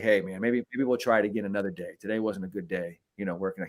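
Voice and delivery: soft, kind send-off; gentle dismissal. Retold or imitated. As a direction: ease him out the door softly.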